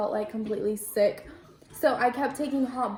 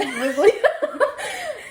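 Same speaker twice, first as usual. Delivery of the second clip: high burst of laughter; 12 semitones higher, 6 dB louder than usual. Real, not acted. Laughs mid labor story.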